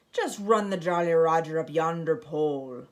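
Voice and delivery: captain's parting words. A narrator's reading. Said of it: "Braidbeard added".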